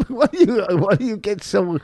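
laughing through the words; high giggly teasing question. Real, not acted. Laughs while teasing wife.

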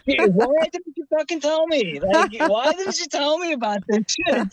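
high, indignant whine; hammed-up indignation at her; mock outrage. Complaining about the girl's towels, mock outraged.

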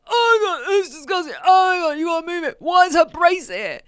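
put-on high, loud, disgusted squeal of a teenager; disgusted shriek. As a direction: shriek it like a grossed-out teen. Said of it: "And she's like".

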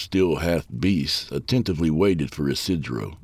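plain reading voice

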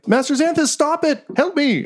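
very high, frantic little robot; panicked robot. Acted. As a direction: shriek for help like a tiny droid. Robot panics, tries to flee.

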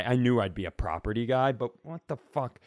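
ordinary delivery

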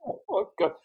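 high mock-weepy "oh God", overcome; imitating tearful wail. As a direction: replay the crew in tears, a touch hammed-up. All sobbing on set.

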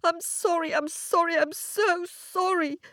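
high, acted weeping apology; tearful little girl. A narrator's reading. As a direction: plead sorry in a high child's voice, as if weeping. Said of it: "wept the little girl".

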